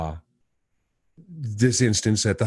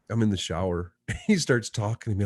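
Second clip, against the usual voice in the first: ordinary delivery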